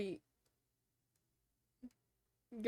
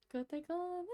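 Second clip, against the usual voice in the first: high-pitched try; 8 semitones higher, 5 dB quieter than usual. Shown as a demonstration. Streamer tries the song title in its high voice, soft and lifted. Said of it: "My voice isn't high enough for this".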